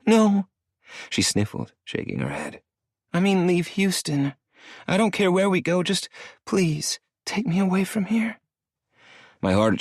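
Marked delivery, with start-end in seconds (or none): 0.1-0.5 put-on high girlish voice, sniffling no; tearful young woman. Star crying, refusing Maddie's misunderstanding, high and tearful. 3.1-8.4 put-on high girlish voice, sniffling no; tearful young woman. Star crying, refusing Maddie's misunderstanding, high and tearful.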